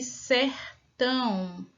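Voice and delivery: sertão slowed way down, split into two parts; syllable-by-syllable. Shown as a demonstration. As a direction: drag out sertão so kids hear the parts. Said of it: "Quantos pedacinhos foram separados?".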